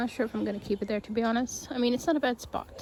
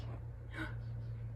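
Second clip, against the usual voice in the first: soft gasp; 13 dB quieter than usual. Real, not acted. Bird flies up.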